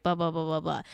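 dismissive mocking blah. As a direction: brush it off like nonsense chatter. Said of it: "she's mocking this article".

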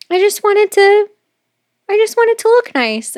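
high, put-on pleading; defensive girlfriend. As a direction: act out her innocent excuse in a high voice. Girlfriend's defensive reply acted out.

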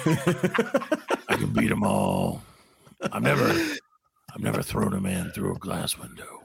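slow, very low tough-guy send-up; Steven Seagal. Acted. Host tries his Seagal accent, low sinister boasting. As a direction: boast in a low mock action-star voice. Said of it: "how to do a Steven Seagal accent".

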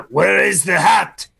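a Frenchman calling out, laughing; mock French shout. Co-host echoes the French punchline.

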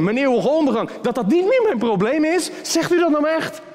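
high, astonished questions to God; incredulous pleading. Acted. Sinner asking God if it is really true, incredulous. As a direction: ask in disbelieving wonder.

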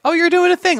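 high, loud mock-polite brush-off; dismissive family reaction. Family member shrugging off the podcast, mock dismissive. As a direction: play the relative who pats you on the head. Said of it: "mostly they're just like".

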